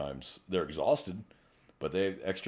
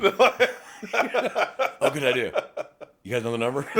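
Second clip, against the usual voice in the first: high, laughing eager thanks; embarrassed laugh through it; laughing sheepish reply; laughing mock gratitude; 6 semitones higher, 5 dB louder, much slower than usual.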